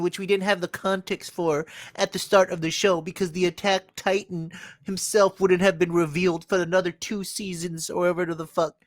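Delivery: put-on high voice, poking fun at co-host; Josh. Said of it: "That's what you sound like".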